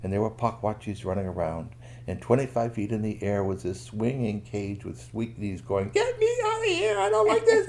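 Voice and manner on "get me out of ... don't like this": very high, loud sung whine; singsong plea. Acted. Weak Knees singing from the cage to be let out, very high. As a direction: sing out a whiny plea.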